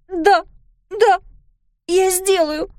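put-on high, loud girl's voice breaking into crying; a child giving in through tears; tearful girl. A narrator's reading. (высокий громкий плачущий детский голос)

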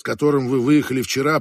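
ordinary delivery, nothing marked

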